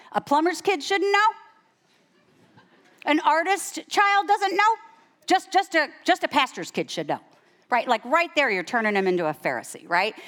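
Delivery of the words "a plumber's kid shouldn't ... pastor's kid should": high, loud rhetorical disbelief; mock incredulity. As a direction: challenge them with rising, incredulous questions. Mocks a double standard.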